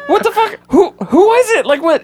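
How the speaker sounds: very high, loud mock freakout; baffled texts. Texting friends after the screening, high mock freakout. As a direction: squeal out the confusion. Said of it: "texting people like".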